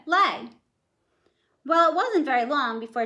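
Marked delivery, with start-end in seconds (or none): 0.1-0.5 commanding. Acted. Jack commanding the hen.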